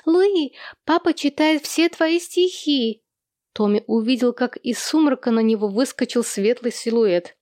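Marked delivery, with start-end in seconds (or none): 0.1-3.0 put-on high, drawn-out child's call; slow, high-pitched boyish announcement; tattling little boy. A narrator's reading. Boy calls out news to sister.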